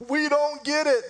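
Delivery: high, loud mock cry of confusion; mock baffled crowd. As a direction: squeal it like a clueless crowd.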